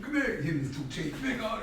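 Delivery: mock summons.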